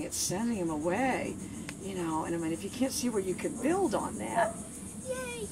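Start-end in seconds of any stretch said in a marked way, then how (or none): 5.1-5.5 very high, soft squeal; happy praise. Real, not acted. Trainer praising the dog, high soft squeal. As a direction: squeal with delight.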